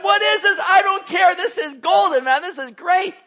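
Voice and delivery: high and loud, greedy glee; mock delighted eating. Preacher voicing Israelites chowing on manna, loud mock delight. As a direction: gobble it up happily, no questions asked. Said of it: "They're like".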